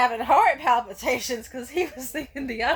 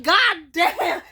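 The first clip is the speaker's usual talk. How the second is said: loud, higher retold exclamation; exasperated outburst; 7 semitones higher, 9 dB louder than usual. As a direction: burst out as the stunned boyfriend. Retelling his exasperated outburst.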